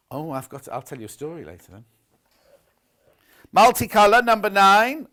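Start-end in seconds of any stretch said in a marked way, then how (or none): 3.5-5.1 loud sing-song bingo call; bingo caller. Shown as a demonstration. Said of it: "it sound like a bingo caller".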